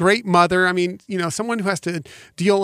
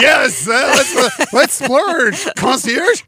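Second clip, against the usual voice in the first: higher and louder, a gleeful big spender; playful higher mock voice urging a spending spree; mock splurger voice; 7 semitones higher, 5 dB louder than usual. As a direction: ham up the one who always wants to splurge. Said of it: "'cause I'm the more like".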